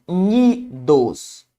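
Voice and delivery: "Unidos" spoken slowly, syllable by syllable, u-ni-dos; syllables pulled apart. Shown as a demonstration. Teacher spelling out "Unidos" syllable by syllable for Marcelo. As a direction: stretch "Unidos" out one syllable at a time. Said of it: "Ao separar unidos".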